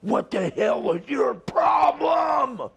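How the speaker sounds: high, slow furious yell; angry wrestler shouting at his opponent. Retold or imitated. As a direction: bellow it at him in the ring. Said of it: "he starts getting mad".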